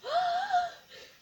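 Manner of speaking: high squealing laugh. Real, not acted. Fan delighted by the package, squealing laugh. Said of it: "I'm squealing".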